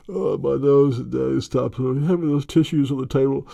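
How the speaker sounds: high, whiny stuffed-up complaint; sick whiny voice. Pretend. Sick child whining to daddy about the tissues, high. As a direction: whine like a kid with a cold.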